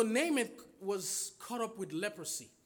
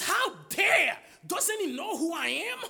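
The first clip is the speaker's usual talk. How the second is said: higher and louder, an offended general shouting; angry shout; 8 semitones higher, 5 dB louder than usual. Acted. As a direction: roar at the insult, indignant and loud. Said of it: "And Naaman was angry".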